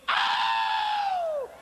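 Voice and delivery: high piercing shocked cry; mock horrified lady's shriek. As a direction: let out a high startled oh. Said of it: "So the lady, she goes".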